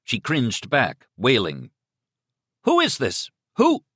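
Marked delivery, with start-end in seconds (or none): 2.6-3.8 high, urgent phone demand; alarmed shout. Acted. Man on phone hears screams, shouting in alarm. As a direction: shout for an answer.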